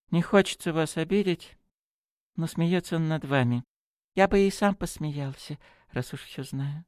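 high-pitched, sheepish priest; apologetic. A narrator's reading. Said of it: "виновато начал Браун". (виновато, извиняясь, высоким голосом)

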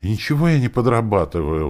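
huffy high-voiced retort; indignant. A narrator's reading. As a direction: protest it, affronted. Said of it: "возмутилась она".

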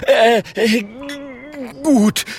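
high, loud, halting nervous stutter; stammering. A narrator's reading. Klonk faces the staring bear, stammering. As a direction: stumble into the word in a high voice. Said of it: "stottert Klonk".

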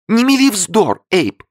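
very high, loud whimper. A narrator's reading. Li whimpers at Abe. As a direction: whine it out tearfully. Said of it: "захныкала Ли".

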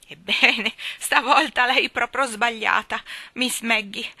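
put-on a boy hiding his surprise behind a loud, slow put-down; secretly surprised. A narrator's reading. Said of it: "segretamente stupito". (sorpresa nascosta, tono di superiorità più forte e lento)

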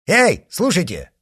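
ordinary delivery

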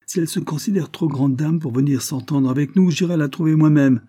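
angry creditor shouting at the butler. A narrator's reading. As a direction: bellow the threat.

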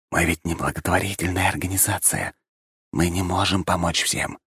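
whispered warning into his ear; breathy hushed whisper. Acted. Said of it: "на ухо шепнул мне Шей".

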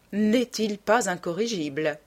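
plain reading voice

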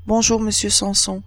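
ordinary delivery, nothing marked

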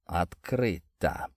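low, soft, drawn-out mutter; slow, quiet murmur to oneself. A narrator's reading. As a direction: mumble it slowly under your breath. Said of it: "пробормотал Степаныч".

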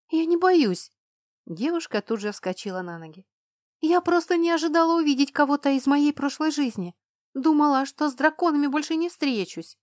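high, loud nervous protest; a scared girl denying her fear, high and loud; agitated protest. A narrator's reading. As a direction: insist loudly that you're not afraid.

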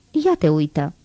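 offhand, absent remark; indifferent. A narrator's reading. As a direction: say it as if thinking of something else. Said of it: "Rosti cuvintele astea cu nepăsare".

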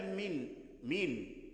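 MIN drawn out on a clear N, softly; noon sakinah example. Shown as a demonstration. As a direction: say "من" slowly with a held, plain noon. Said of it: "فتقول مثلًا".

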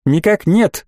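ordinary delivery, nothing marked

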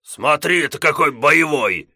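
mock his fighting spirit; mocking chuckle. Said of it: "усмехнулся Гос".

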